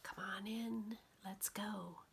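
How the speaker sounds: soft unvoiced whisper. The pond whispers to the scared duck, soft unvoiced whisper. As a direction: breathe it out like the pond calling. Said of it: "It's whispering".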